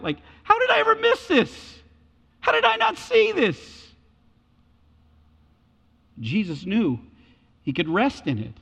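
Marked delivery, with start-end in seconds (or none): 0.4-3.9 shocked disbelief. Retold or imitated. Betrayed person realizing the deceit, high stunned disbelief. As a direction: realize you've been fooled. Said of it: "You feel like a fool".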